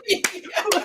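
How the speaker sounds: high hammed-up villain cackle; over-the-top evil laugh. Mimicking the villain's evil laugh, hammed up. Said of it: "going over the top evil laugh".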